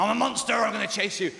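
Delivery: high, loud mock monster threat; playful monster announcing a chase; dad playing monster. Dad to his little kid in a game, mock monster voice. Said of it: "my dad going".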